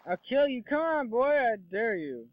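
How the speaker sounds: loud, high mock threat to a game enemy; mock taunt. Player taunting an enemy character, loud hammed-up threat.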